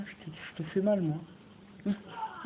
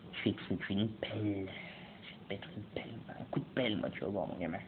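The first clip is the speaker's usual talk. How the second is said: low, hushed threat; mock menace; 7 semitones lower, 9 dB quieter than usual. Threatening a zombie with shovel. (menace feinte, grave et tout bas)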